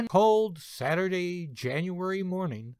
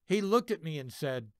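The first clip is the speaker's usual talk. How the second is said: plain reading voice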